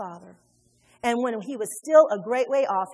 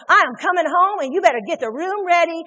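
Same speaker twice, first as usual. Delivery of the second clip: unhumbled demand; 2 semitones higher, 6 dB louder, faster than usual. Acted. Preacher imitating relative phoning Grammy, bossy and demanding. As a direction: order grandma around like you are owed it. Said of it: "That's not humbling themselves".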